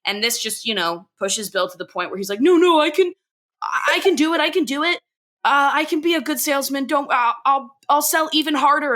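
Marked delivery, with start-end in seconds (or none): 2.4-8.9 high, insistent, stammered promise; desperate mock pleading. Bill begs Peggy to keep him selling, desperate. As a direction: plead to be given another chance, voice pitched up.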